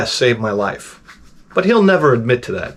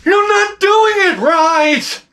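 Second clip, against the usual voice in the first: high-pitched, drawn-out imitation of yelling; mock yelling; much slower than usual. Wife scolding husband in the pool, mock yelling. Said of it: "she starts yelling at me".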